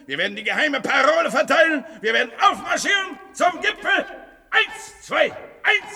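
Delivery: fast, rising shouted oration; building rally. Acted. Would-be Führer rousing the cellar crowd, shouting. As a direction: whip the crowd into a frenzy.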